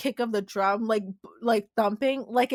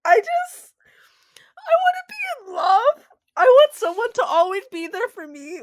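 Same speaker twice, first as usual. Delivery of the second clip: much higher, louder, yearning cry; lovesick swoon; 10 semitones higher, 5 dB louder than usual. Real, not acted. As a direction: gush like a lovesick fan. Swooning over romantic line.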